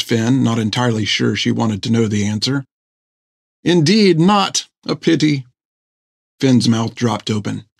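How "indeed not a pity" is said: lightly raised, prim doctor voice; a prim, fussy gentleman. Acted. Doctor Thiggum answers.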